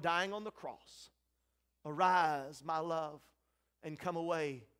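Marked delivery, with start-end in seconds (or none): 1.9-4.7 fatherly call. Acted. Father answers the one dying on the cross, low tender call. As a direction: beckon gently in a lowered voice. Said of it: "Abba heard the cry".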